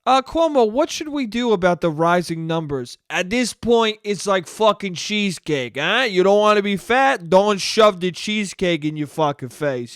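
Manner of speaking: put-on a light Italian New York accent; hint of an Italian New York accent; Italian New Yorker. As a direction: play a mock Italian uncle. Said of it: "What an Italian move to just be like".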